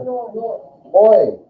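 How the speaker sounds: "Oi" high; bright "Oi" demo; teacher sounds out "Oi"; diphthong demo. Shown as a demonstration. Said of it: "E U makes a, like an O Y sound".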